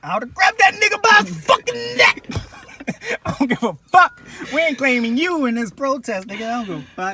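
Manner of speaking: high, loud hammed-up rant; mock rage. Ranting about grabbing the protester. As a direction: scream it in mock fury.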